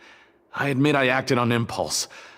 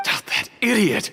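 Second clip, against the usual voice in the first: angry outburst; 9 semitones higher than usual. Acted. Learns accomplice confessed.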